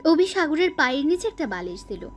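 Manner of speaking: ordinary delivery, nothing marked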